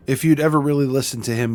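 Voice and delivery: usual speaking voice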